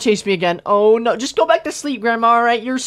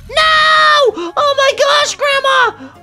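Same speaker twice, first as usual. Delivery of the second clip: sudden high, loud scream of no; panicked shout; 12 semitones higher, 4 dB louder, much slower than usual. Real, not acted. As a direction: scream it as she jumps out. Grandma appears suddenly.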